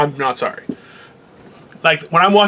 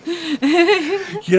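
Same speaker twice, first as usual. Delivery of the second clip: laughing; 9 semitones higher, 4 dB quieter than usual. Real, not acted. Laughing, high and slow.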